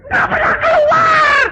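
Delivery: shouted comic punchline. Retold or imitated. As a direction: belt the punchline out high and slow. Punchline, father never pays.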